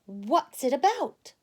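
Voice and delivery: curious storybook mom; lightly voiced Mama Bear.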